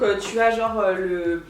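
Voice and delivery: usual speaking voice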